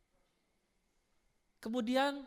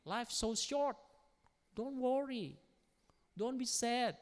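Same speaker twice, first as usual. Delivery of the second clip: ordinary talk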